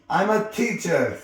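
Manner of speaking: high, loud indignant roar; outraged bellow; angry roar. A narrator's reading. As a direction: roar it at a cheeky pupil. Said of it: "roared at Harry".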